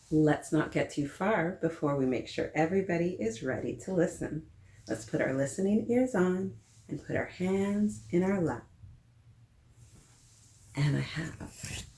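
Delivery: plain reading voice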